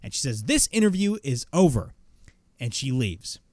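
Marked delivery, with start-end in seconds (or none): 0.4-1.9 mock yell, higher and louder; retold screaming. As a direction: imitate an outraged walk-off, raised voice. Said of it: "then she starts screaming".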